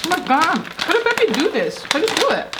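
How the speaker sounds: high amazed question. Real, not acted. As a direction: wonder how he managed it. Amazed at child opening.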